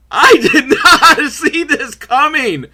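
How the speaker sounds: slow, high, laughing through disbelief; shocked laughter. Real, not acted.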